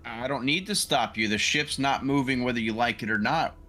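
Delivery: ordinary talk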